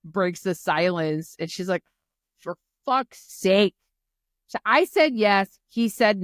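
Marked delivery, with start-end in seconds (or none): 2.4-3.7 louder, raised-pitch mock exasperation; exasperated outburst. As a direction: retell her fed-up outburst with force. Retold exasperated Kat breaking the silence.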